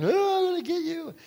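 mock whiny child's yell, pitched high; high crying little kid threat; angry crying kid. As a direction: imitate a furious little boy swinging. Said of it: "I was probably crying".

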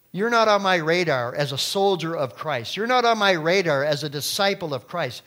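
loud, then high and mocking; forceful preaching. Preacher voicing the demon's scorn for the seven sons. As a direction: throw the demon's challenge at them.

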